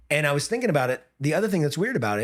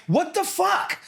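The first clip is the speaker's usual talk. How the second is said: incredulous outburst; much slower than usual. Retold or imitated. Coach turning round to the judges, incredulous outburst. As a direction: turn round in exasperated disbelief. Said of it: "I literally turned around. I was like".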